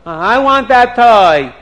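put-on spoiled little boy insisting; demanding child. Little prince at the shop window, loud demand. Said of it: "he starts screaming and yelling".

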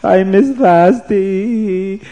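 slow, wailing, half-sung lament; a hammed-up sobbing wail; mock crying king. King cries in bed over his wife, slow mock wail. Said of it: "started to cry".

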